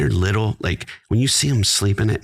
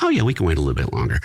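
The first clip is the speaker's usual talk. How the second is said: hasty mock agreement; 4 semitones lower, much faster than usual. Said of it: "we'd be like".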